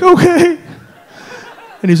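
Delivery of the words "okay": high, loud little okay; timid agreement. Retold or imitated. Teller answering a giant serpent, high compliant voice. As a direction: pitch it up, meekly agreeing. His small reply to the serpent.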